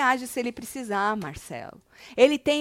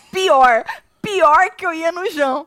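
high, very loud repeat of a comment; loud exclamation; 6 semitones higher, 12 dB louder, slower than usual. Retold or imitated. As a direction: blurt it out loudly in amazement. Repeats viewer's comment in loud disbelief.

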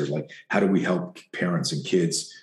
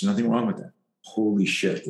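usual speaking voice

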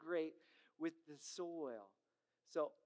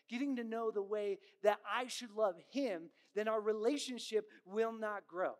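louder, driving pulpit delivery; preaching at full force; 4 semitones higher, 5 dB louder than usual. Acted. Pastor urging the congregation about relationship with God, forceful preaching. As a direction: preach it with rising conviction.

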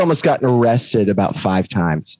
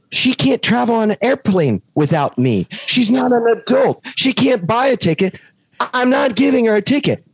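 raised, pitched-up mock outrage at the gate agent; indignant retold protest; 11 semitones higher than usual. Retelling arguing with the ticket lady, mock outrage. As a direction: argue it's unfair, climbing higher.

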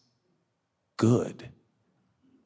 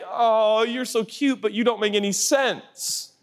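patronizing; 10 semitones higher than usual. Retold or imitated. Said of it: "Because it's like".

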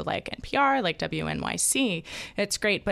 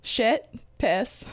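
giggly; 5 semitones higher than usual. Real, not acted. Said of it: "Just say them".

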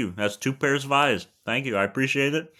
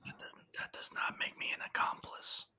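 softer hushed denial; whisper; 16 dB quieter than usual. Acted. Partner whispering that he is no accomplice, whispered. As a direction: whisper it back secretly.

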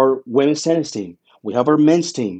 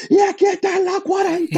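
much higher, racing touchdown call in Spanish; excited Spanish play-by-play call. Retold or imitated. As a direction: shout the call as the runner breaks away. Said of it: "it had to be this call".

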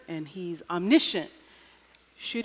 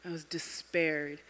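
slow, unvoiced whisper of despair; 4 semitones lower, 8 dB quieter than usual. To God at night, a slow despairing whisper. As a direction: breathe it out as if crushed. Retelling her despair at prayer.

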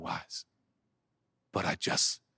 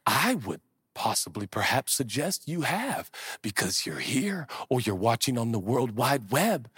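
ordinary delivery

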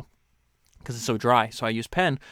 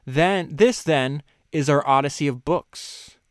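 louder, slow reading; slow reading aloud; 3 semitones higher, 5 dB louder, slower than usual. Acted. Host starting a new passage to listeners, slow. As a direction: announce the line.

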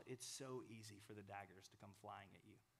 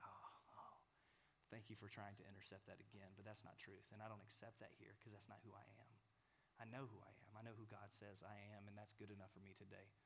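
quiet inner rebuttal; 3 semitones lower, 9 dB quieter than usual. Acted. Calm inner reply to lies.

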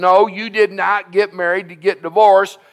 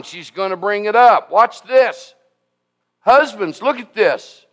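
ordinary talk

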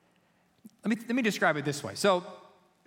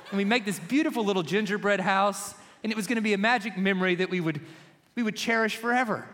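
normal talking voice

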